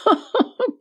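high scornful laugh; short mocking laugh. A narrator's reading. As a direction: laugh at the offer in disbelief. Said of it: "вызвал у неё смех".

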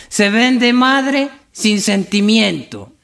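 high, very drawn-out lament on "madre sin sentimiento"; tearful misreading. Acted. Drunk misreads a sign to his compadre, slow weepy lament. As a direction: stretch "madre sin sentimiento" slowly in mock grief. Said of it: "Vea ese letrero que dice ahí".

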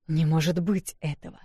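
usual speaking voice

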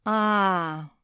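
long, loud, falling "ah"; drawn out. A narrator's reading. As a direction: stretch it out as realization dawns. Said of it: "протянул Зефир".

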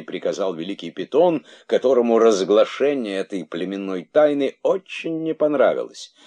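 ordinary delivery

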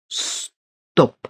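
breathy whispered «Стоп»; whispered stop. A narrator's reading. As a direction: whisper the word to yourself. Внутренняя команда себе. (шёпотом «Стоп»)

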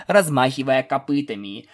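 usual speaking voice

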